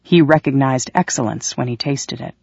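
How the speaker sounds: ordinary delivery, nothing marked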